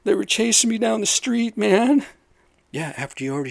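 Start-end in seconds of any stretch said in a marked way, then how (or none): 0.0-2.0 high loud whining plea, slow start; pleading in fake fright; mock whiny defendant. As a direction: ham up a scared excuse. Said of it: "some sort of".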